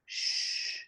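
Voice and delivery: long unvoiced "shh"; quiet the chattering with a drawn-out shush; hushing. Storyteller getting everyone to stop talking, hushing. Children told to hush the chatter.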